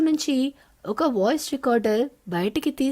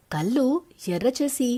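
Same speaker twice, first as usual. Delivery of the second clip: usual speaking voice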